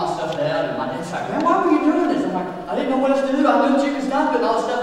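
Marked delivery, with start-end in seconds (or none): 1.3-2.3 much higher, louder scolding; a dad half-yelling at his son; high, louder exasperated outburst. Retold or imitated. Dad scolding after crash. 2.7-4.8 flustered blabbering. Retold or imitated. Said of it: "kinda blabbering this stuff out".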